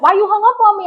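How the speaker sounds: high, loud imitation of his complaint; indignant demand. Boyfriend calling back after a hang-up, high indignant demand. He demands why she hung up.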